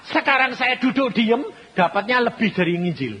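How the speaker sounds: high, loud preaching voice. Acted. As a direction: lift the testimony up like a preacher at full force. Preaching testimony at full force. (khotbah berapi-api dengan suara tinggi dan lantang)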